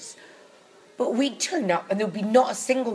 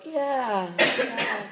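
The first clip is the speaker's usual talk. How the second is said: vague, higher-pitched mock-stoned reply; dazed stoner; 4 semitones higher than usual. Stoned actress answering her prompter, dazed. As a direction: imitate someone barely following along. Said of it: "a drug-induced haze".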